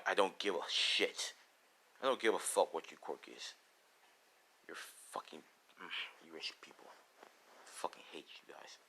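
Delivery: low muttered loathing, sinking to a whisper; hushed, bitter hostility, very soft; quiet contempt. Acted. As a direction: seethe under your breath. Deku spits contempt at rich classmates.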